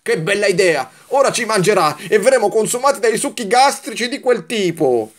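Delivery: high, sour mock cheer turning to complaint; complaining candy. A narrator's reading. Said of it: "Kalin tornò a lamentarsi".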